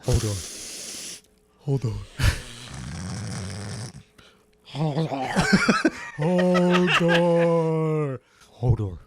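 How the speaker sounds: low, dim-witted giant Hodor voice; Hodor impression. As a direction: repeat it like a simple giant. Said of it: "That's pretty good".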